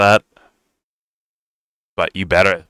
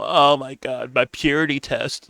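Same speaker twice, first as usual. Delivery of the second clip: mocking whine; 6 semitones higher, 4 dB quieter, slower than usual. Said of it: "I'm going into my whole".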